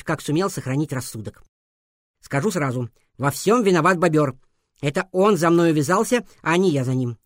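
plain reading voice

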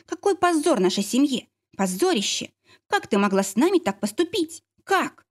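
narrated outrage of a mother, pitch leaping on the questions; outraged cry. A narrator's reading. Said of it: "закричала она".

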